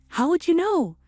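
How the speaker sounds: high, caught-off-guard retort; startled and defensive; defensive surprise. Acted. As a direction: caught out, snapping back a question.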